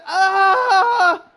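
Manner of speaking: high loud yelp; mock strained yell. Co-host mocks Brie Bella's failed yell, high strained yelp. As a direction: mimic a cry that isn't working. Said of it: "She said".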